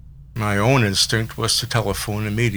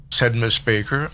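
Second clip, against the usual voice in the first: ordinary delivery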